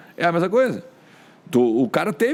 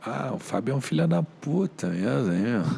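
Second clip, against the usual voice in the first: lightly voiced player, softer and griping; player's reply; 3 semitones lower, 5 dB quieter than usual. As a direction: mimic a player grumbling back, softly.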